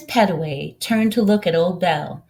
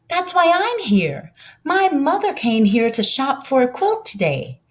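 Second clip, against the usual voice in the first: lightly voiced eager boy, pitched up high; a boy explaining why he came, a bit higher; the boy explains his mother's errand, lightly higher; young boy Alex; 7 semitones higher than usual. Child Alex speaking to Miss Pedway.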